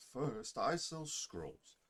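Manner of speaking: attempted Russian shopkeeper accent. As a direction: pitch your wares in a put-on Russian accent. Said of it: "I don't know why I'm trying to do Russian".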